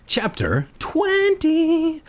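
high, slow, drawn-out announcer voice; stretched, lofty chapter title; dramatic announcement. Acted.

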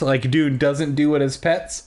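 ordinary delivery

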